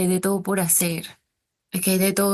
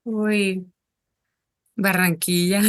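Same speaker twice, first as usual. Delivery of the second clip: ordinary talk, nothing marked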